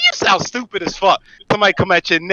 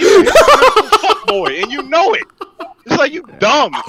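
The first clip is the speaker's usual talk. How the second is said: worked-up; 9 semitones higher, slower than usual. Real, not acted. Riled co-host insults the other man mid-argument, worked-up. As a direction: tear into him, voice pushed up. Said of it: "Why are you getting so emotional".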